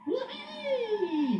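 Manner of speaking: very high, loud falling whee; gleeful whee. Acted. Freddie whizzing across the depot, gleeful whee. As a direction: shout for joy while skidding. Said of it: "he went".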